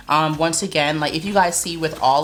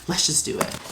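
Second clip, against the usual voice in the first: whispered; 4 dB quieter than usual. Real, not acted. Opening the box.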